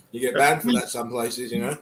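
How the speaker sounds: ordinary talk, nothing marked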